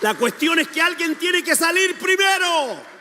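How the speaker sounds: high-pitched, full-force exhortation; preaching shout. Real, not acted. Preacher telling the church someone must go first, preaching shout. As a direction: cry it out over the crowd.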